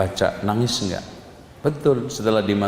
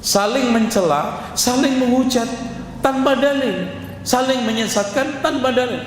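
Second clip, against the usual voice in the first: fiery preaching; 10 semitones higher than usual. Acted. Preacher rousing the congregation about music, full-force preaching. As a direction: preach it hard to the crowd.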